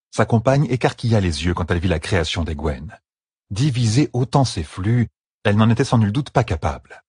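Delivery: plain reading voice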